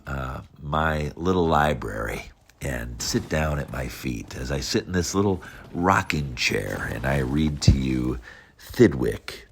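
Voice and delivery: ordinary delivery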